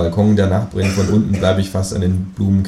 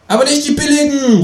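high, louder kangaroo call; 6 dB louder than usual. Acted. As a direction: shout after him as the kangaroo. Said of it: "ruft das Känguru". (lautes, hohes Känguru-Rufen)

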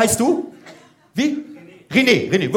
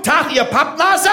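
barked greeting; 8 semitones higher than usual. Shown as a demonstration.

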